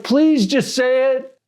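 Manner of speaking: high, slow mock plea; frustrated pleading. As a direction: imitate a frustrated teen begging. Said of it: "then he gets frustrated".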